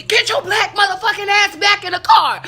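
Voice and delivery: loud, high-pitched yell, mimicking an officer; shouted police order. Officer ordering her back into the car, shouted. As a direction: bellow an angry command, acting out the cop.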